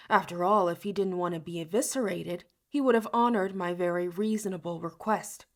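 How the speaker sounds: plain reading voice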